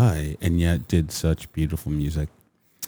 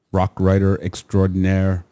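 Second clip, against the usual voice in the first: normal talking voice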